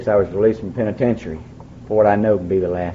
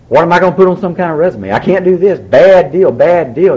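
panicked; 6 semitones higher, 6 dB louder than usual. Retold or imitated. Panic about resume.

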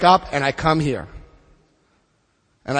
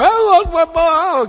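high, slow, whiny mock self-pity on "I lost my mom"; 11 semitones higher, 4 dB louder, slower than usual. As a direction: imitate a pitiful excuse to drink, drawn out and high. Mocking a relapsing drunk's excuse.

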